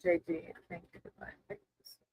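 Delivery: hushed, murmured invocation to spirit; whispered prayer. Real, not acted. Tarot reader asks Divine for guidance on JD's dream, whispered. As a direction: pray softly under the breath, asking for guidance. Calls on higher power.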